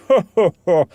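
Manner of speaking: loud sudden laugh; hearty laugh. Acted. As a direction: laugh off the scare. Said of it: "Then Meagle laughed".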